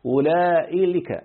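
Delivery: أولئي لك slowed, long "laa", then "lika" tacked on; wrong lam form. Shown as a demonstration. Teacher showing students a disallowed form, slow stretched "ulaa'ilika". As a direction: draw out أولئي and join لك straight on. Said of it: "لا تقل أولئي".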